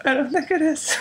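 high, put-on falsetto reply; creepy voice. Host staying in character with co-host, creepy high voice. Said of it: "creepy voice for".

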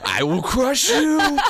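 high, slow barbarian roar with laugh. Pretend. Mock-furious threat in role-play.

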